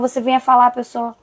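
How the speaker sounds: ordinary talk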